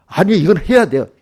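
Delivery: loud, high protest; mock loud insistence; insistent retort. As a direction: insist loudly it must be done. Insisting retort in retold scene.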